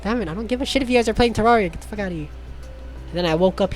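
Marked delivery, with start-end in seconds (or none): none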